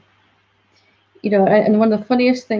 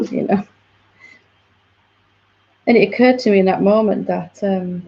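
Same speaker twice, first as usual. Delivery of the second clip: ordinary talk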